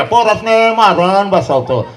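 slow, drawn-out mimicry of an online critic; mocking a critic. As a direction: drag the words out to ridicule the complainer. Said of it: "social मीडियावरचं म्हणतंय". (टीकाकाराची लांबवलेली, खिल्ली उडवणारी नक्कल)

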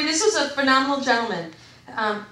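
ordinary talk

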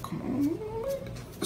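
high whimpering beg; mock crying plead; whiny plea. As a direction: whine it like a kid denied candy. Begging her for head.

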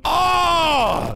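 long, high, loud "oh" cry. Acted. Late worker bursting in on the boss, loud cry. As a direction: wail out one loud held note.

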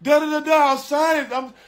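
high-pitched mock crying plea; imitated tearful pleading. Bride pleads with groom to sign, mock-sobbing high voice. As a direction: imitate her begging through tears, high and repetitive. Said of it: "she hard down crying".

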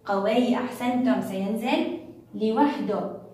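ordinary delivery

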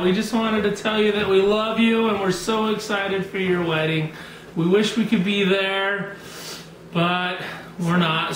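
ordinary talk, nothing marked

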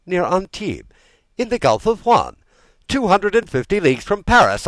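high, loud, mounting incredulity; furious, alarmed interrogation; outraged disbelief. A narrator's reading. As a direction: build to indignant royal outburst. King aghast at invasion news.

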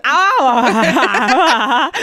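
long, loud garbled "ah"; word-vomit noise. Retold or imitated. Storyteller demonstrating own word vomit, loud garbled noise. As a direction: spill out a wordless blurt. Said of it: "Oh, I did word vomit. I said".

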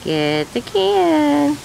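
high sing-song pet voice; teaching a parrot. Real, not acted. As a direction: coax the bird, high and sweet. Coaxing a parrot to talk.

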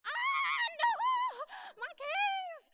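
very high, loud panicked wail; imitating a friend losing it completely, at full volume; mock freak-out. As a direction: shriek it as a hammed-up panic. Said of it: "she's already freaking out at this point".

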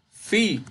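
"Fi" said alone, high and emphatic; stressed syllable FI. Shown as a demonstration. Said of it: "ahí lleva la tilde en fi".

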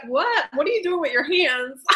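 ordinary talk, nothing marked

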